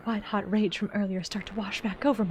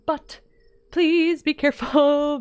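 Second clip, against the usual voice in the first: ordinary talk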